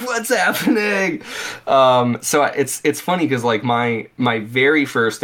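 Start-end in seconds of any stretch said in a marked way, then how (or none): 0.0-1.2 high, drawn-out mock panic; mock bewildered cry. Acting out his dazed reply to his yelling friend, high bewildered cry. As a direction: stretch it out high, playing overwhelmed. Acts out own bewilderment on stage.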